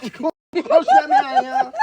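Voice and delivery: giggling at her own confusion; laughing. Real, not acted.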